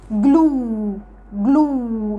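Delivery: "جلو" with a long, held "oo"; double-O sound. Shown as a demonstration. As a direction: say "جلو" as "gloo", stretching the vowel. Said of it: "نطقتها اتنين او". (نطق "جلو" بواو ممدودة)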